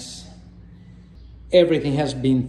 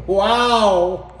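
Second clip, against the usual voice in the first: excited; 7 semitones higher, 7 dB louder than usual. Real, not acted. Laughing exclaim at view.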